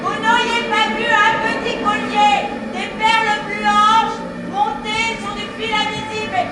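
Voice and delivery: fort et haut, insistante; appeler un passant. Acted. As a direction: interpelle quelqu'un dans la rue. Appelle un passant dans le bruit. (demande fort à un passant s'il a vu le collier)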